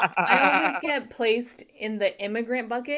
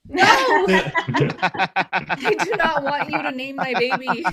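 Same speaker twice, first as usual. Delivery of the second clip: mock outrage; 7 semitones higher, 7 dB louder than usual. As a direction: object loudly to the ridiculous name. Mock argument over naming the baby.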